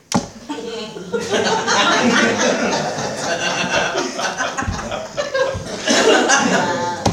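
long breathy laughter; long real laugh. Real, not acted. Speaker laughing at Bob's prank, long real laugh. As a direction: laugh it off at the story's end.

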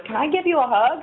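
high, timid little question; timid ask. Retold or imitated. Scared old lady asks the biker for a hug, timidly high. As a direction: ask shyly, half expecting a no. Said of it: "she was kind of scared".

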